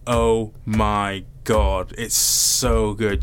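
very slow, drawn-out words; slow, savoured rave; emphatic gushing. Real, not acted. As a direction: stretch every word out for emphasis. Gushing praise of a comic.

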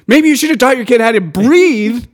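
loud, high mocking scold; loud sarcastic rant. Real, not acted. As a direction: tell off the customer loudly. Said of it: "you're very loud".

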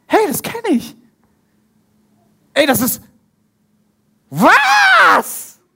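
rising in disbelief to a high, loud shout on the final word; stunned recognition. Acted. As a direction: disciples at the table suddenly recognise Jesus, building to an astonished shout. Disciples recognise Jesus breaking bread.

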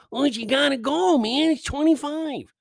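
high pushy friend voice; imitating Elliot.

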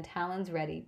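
plain reading voice

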